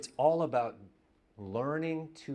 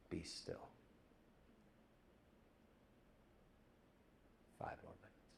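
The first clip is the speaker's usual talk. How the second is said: each phrase softer, ending in a whisper; retelling fading into silence; 4 semitones lower, 17 dB quieter than usual. Retold or imitated. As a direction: let the retelling die away to a whisper. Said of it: "And then she says".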